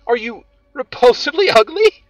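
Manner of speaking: loud, high teasing question that breaks into a laugh. A narrator's reading. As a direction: laugh right through the last word. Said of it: "She laughed again".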